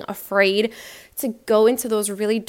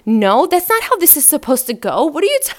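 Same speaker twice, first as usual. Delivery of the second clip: mock panicked guy, louder and rising; imitating panic; 3 semitones higher, 5 dB louder than usual. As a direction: play the flustered guy losing control of the scene. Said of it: "he started panicking".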